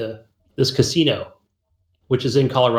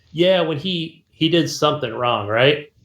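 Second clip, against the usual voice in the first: normal talking voice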